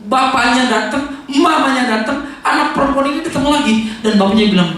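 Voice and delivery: normal talking voice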